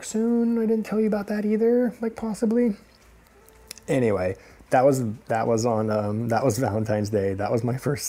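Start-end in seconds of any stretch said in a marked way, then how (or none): 0.0-2.7 very high-pitched mock squeak; high, mock-sheepish voice; sheepish confession. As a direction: slip the admission out in a high voice. Acts sheepish confession to girlfriend.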